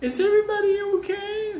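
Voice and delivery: very high sing-song lady voice; ski lady's voice. Retold or imitated. Skiing lady calling to kids, high sing-song voice. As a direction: sweetly ask like a prim skier. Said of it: "this lady comes".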